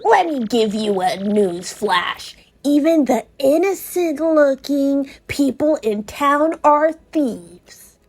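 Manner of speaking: sly thief. Acted. Cloaked thief returns coins to Mario, lightly voiced sly grudging. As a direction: shrug it off like a caught thief.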